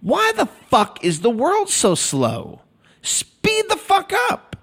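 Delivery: high, slow exasperated yell at the world; impatient rant. Retold or imitated. Complaining to himself about the slow world, exasperated high yell. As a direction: rage at how slow everything is, drawn out. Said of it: "I say to myself".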